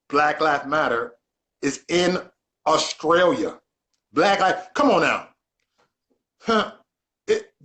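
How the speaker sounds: loud, emphatic disbelief in broken chunks; incredulous. Real, not acted. Host repeating guest's news to his viewers, loudly incredulous. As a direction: hit each chunk hard, pausing between them.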